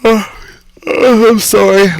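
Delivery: said through a long yawn. Real, not acted. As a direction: stretch the words out mid-yawn. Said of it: "Ah, I'm yawning now".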